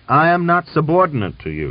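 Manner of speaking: loud, high-pitched denial; forceful defiance. A narrator's reading. Officer rejecting the general's authority, defiantly.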